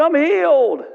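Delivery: high, loud burst of joy; joyful cry. Retold or imitated. Old lady crying out after popping out her hearing aids, high and loud. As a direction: shout it out, amazed at being healed. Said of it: "She said".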